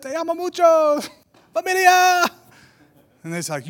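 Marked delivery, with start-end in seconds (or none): 0.0-2.3 high, called-out reply, last word louder; exuberant reply. Retold or imitated. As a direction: shout the greeting back, high and stretched. His own shouted reply in Spanish.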